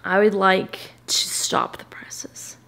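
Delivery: slow hushed announcement; mock-dramatic whisper. Vlogger announcing to viewers a big reveal, slow whisper. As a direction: whisper it like breaking news.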